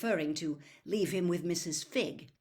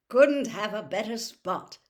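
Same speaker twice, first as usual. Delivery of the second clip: louder, cheerful and pleased; happily; 2 semitones higher, 8 dB louder than usual. A narrator's reading. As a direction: beam with delight at the spot. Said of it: "said Mister Weasley happily".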